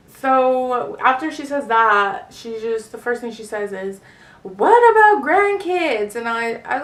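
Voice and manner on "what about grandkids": loud, high, drawn-out outcry; mock a mother's dismayed demand; alarmed mom. Mom's first worry, exclaimed.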